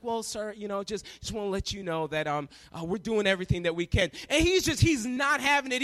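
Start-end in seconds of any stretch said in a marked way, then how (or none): 0.0-4.1 soft, low, hesitant customer service; counter lady. Retold or imitated. Counter agent placates an impatient passenger, softly apologetic. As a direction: imitate a placating agent feeling for words. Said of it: "She's like".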